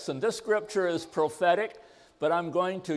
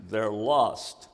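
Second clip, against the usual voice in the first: usual speaking voice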